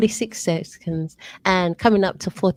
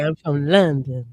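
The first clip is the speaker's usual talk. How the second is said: attempt at bass; 5 semitones lower than usual. Shown as a demonstration. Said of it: "Sound a little bit more bass".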